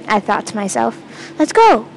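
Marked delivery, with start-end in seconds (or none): 1.4-1.9 higher-pitched rallying call; yelled. A narrator's reading. Friend urging the others into the maze, mild yell. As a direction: call it out to get friends moving. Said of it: "Cassidy yelled at us".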